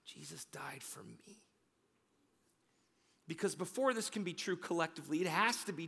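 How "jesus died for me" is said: breathy whispered confession. Shown as a demonstration. Pastor whispering the words to his own heart. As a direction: whisper it like a private prayer. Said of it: "Say that in your heart".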